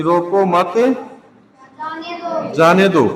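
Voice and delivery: "रोको मत", long pause, then "जाने दो"; pause at comma. Shown as a demonstration. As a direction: hold a long break after "मत", before "जाने दो". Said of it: "यहाँ पे हमने कोमा लगा दिया". ("रोको मत" के बाद लंबा विराम, फिर "जाने दो")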